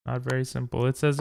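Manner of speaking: usual speaking voice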